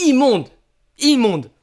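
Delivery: loud, high, disgusted outburst. Real, not acted. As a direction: spit it out in revulsion. Disgusted reaction to an ugly Pokémon.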